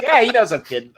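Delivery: high, laughing reassurance; laughing shrug. Retold or imitated. Buddy brushing off the joke, laughing and high. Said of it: "He's like".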